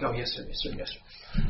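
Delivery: put-on whispered child answering dad; hushed obedient reply. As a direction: hurriedly whisper compliance, as if just woken. Sleepy child answering dad in church.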